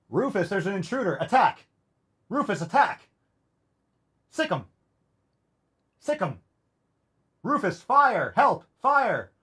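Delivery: high-pitched pretend emergency cries; mock alarm. Owner pretending there's an intruder and fire, mock high-pitched alarm. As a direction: fake panic to rouse a lazy dog.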